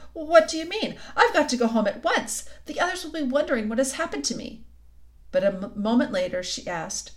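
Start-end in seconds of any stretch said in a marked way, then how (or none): 0.1-4.7 put-on higher, alarmed child's voice; rather frightened. A narrator's reading. Lucy insists to the faun she must leave, frightened. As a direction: speak up in mild alarm. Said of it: "feeling rather frightened".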